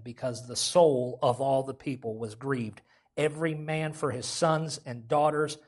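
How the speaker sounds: loud, slowing scripture proclamation; preacher reading the verse to the church; preaching at full force. Acted.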